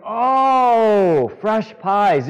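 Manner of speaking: light up as it finally clicks; sudden realization. Retold or imitated. Teen cashier finally getting the order, surprised and loud.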